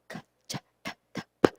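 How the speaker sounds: Kha, Cha, Tha, Tha, Pa breathed with no voice at all; whispered syllables. Shown as a demonstration. Teacher showing students voiced letters said unvoiced, whispering. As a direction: whisper them to show the voice is missing. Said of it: "when we try to say it without this voice".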